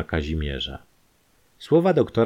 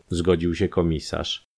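ordinary delivery

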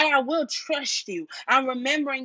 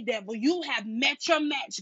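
ordinary talk

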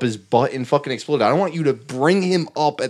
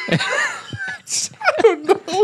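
laughing; much slower than usual. Real, not acted. Laughing fit.

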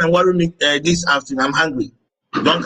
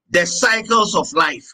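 slow, deliberate teaching with long pauses; measured lecture; slower than usual. Acted. Teacher explaining the cycles of life to students, measured lecture. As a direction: dictate it slowly, phrase by phrase.

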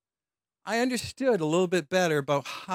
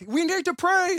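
eager senior students; 10 semitones higher than usual. Retold or imitated. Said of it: "So some of the fourth".

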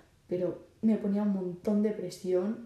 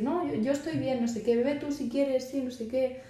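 higher, breezy friendly brush-off; lightly voiced teen turning down a drink kindly; 6 semitones higher than usual. Shown as a demonstration. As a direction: cheerfully wave off the drink and push it back. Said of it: "hace este juego de decir".

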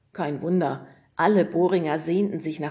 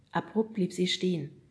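usual speaking voice

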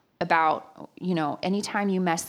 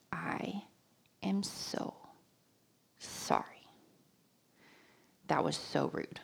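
much softer, near-whispered sorry; whispered, halting apology to her friend; hushed apology; 2 semitones lower, 12 dB quieter than usual. Retold or imitated. Quietly apologizing beside him.

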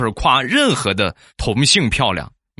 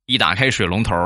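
ordinary talk

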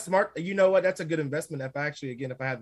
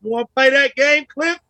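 playful challenge; 7 semitones higher, 10 dB louder than usual. Pretend.

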